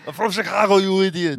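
high mock Austrian accent. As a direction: put on a thick Austrian accent.

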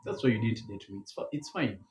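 usual speaking voice

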